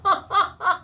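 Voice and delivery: short high burst of laughter; bright laugh. Real, not acted. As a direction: laugh out loud at yourself. Laughing at her own comment.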